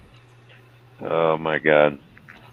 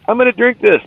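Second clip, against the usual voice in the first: high, louder mock bravado; egging himself on in a high put-on voice; mock tough voice; 5 dB louder than usual. Man daring himself to drink the milk, high mock bravado.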